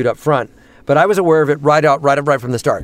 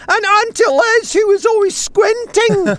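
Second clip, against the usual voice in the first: squinting auntie impression.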